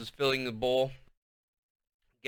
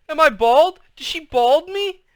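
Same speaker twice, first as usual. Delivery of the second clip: high, loud panicked scream; 10 dB louder than usual. A narrator's reading. Girl screaming at her family about her scalp, panicked. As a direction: shriek in panic about your hair.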